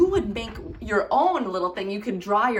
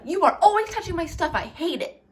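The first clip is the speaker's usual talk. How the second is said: high and fast complaint; high fast accusing whine; annoyed sibling; 7 semitones higher, faster than usual. Shown as a demonstration. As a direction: blurt it out at the sibling. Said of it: "instead of".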